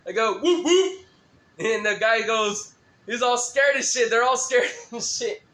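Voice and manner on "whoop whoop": high, loud whoop call; juggalo call. Retold or imitated. Greeting a fellow fan, a high loud whoop.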